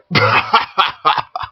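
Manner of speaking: breathy rude cackle; coarse cockney laugh. Acted. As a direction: snigger at the boy. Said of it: "The rudest, coarsest laughter".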